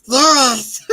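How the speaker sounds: mock Gollum at full volume; Smeagol voice. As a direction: shriek the order like Gollum. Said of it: "Sound like Smeagol".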